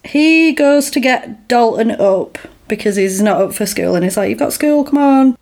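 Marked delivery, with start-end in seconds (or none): 4.3-5.4 raised-pitch, coaxing wake-up call; parent rousing son. Retold or imitated. Parent tells Dalton he's got school, retold chivvying. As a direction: chivvy a sleeping boy along, lightly acted.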